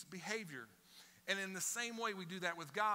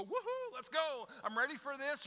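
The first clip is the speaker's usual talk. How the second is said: sarcastic high whoop, much higher; mock cheer; 9 semitones higher than usual. Anxious believer mocking forced joy, sarcastic cheer. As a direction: fake a giddy celebration. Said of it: "you expect me to just go".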